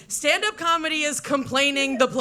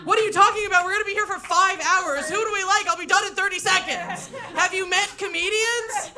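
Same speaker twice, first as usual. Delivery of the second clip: exasperated rant; 6 semitones higher than usual. Retold or imitated. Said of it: "And I turned to him".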